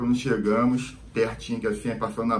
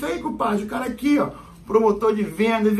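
mocking cop; 9 semitones higher than usual. Cop presenting friend to colleagues.

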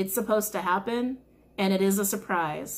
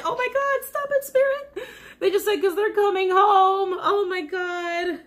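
high, loud, emotional wail; overwhelmed, tearful cry; 10 semitones higher, 5 dB louder than usual. Real, not acted. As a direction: cry it out, overcome and near tears. Overwhelmed to tears by the message.

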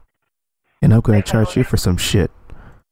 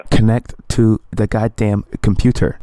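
slowed right down, each word pressed; slow and emphatic. Real, not acted. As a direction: spell it out slowly in frustration. Caller won't hear, repeats.

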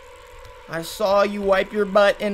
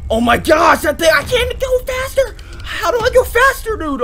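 high, loud, panicked pleading; loud frightened near-crying; 13 semitones higher, 9 dB louder than usual. Real, not acted. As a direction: beg to go faster as it chases. Fleeing the monster in panic.

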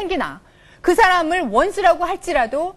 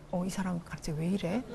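low, much softer puzzled murmur to himself; inner muttered thought; 6 semitones lower, 12 dB quieter than usual. Retold or imitated. Husband puzzling to himself about his wife, low and soft. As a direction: think it quietly, surprised. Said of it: "속으로 그러면서도".

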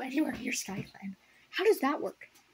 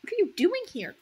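surprised question; 9 semitones higher, 5 dB louder than usual. A narrator's reading. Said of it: "Cloudfrost asked".

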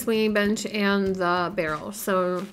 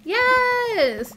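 a much higher triumphant yelp; excited cheer; 12 semitones higher than usual. Real, not acted. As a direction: pop it out like a win. Got the villager.

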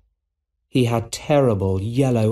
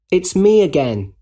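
high, raised call; 8 semitones higher, 4 dB louder than usual. A narrator's reading. Peter calls to MJ on Broadway, calling out. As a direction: call it out to get attention. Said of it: "called Peter".

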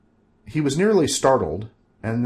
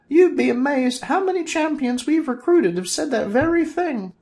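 high, louder sorceress voice; Valerina's voice; 4 dB louder than usual. Acted. Valerina asking Bob, high sorceress voice. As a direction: ask it innocently as the sorceress.

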